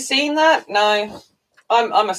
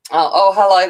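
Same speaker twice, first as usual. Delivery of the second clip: ordinary delivery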